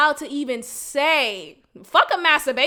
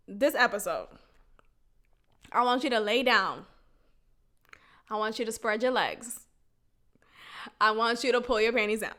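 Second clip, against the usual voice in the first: mock instructions; 5 semitones lower, 7 dB quieter than usual.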